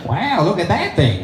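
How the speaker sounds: high, drawn-out mock-Chinese voice; Chinese accent. Chinese astronaut feigning innocence with a screwdriver, mock Chinese accent. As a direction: marvel slowly in a caricature Chinese accent. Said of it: "the Chinese guy over in the corner".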